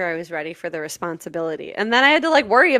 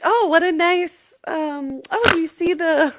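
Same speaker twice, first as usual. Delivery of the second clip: forced politeness; 7 semitones higher than usual. Retold or imitated. Retold forced polite praise.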